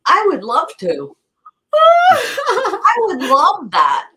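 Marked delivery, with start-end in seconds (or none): 1.7-4.1 much higher, louder and slow, laughing through the words; thrilled laugh while accepting the offer; delighted laugh. Real, not acted. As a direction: burst out with real delight.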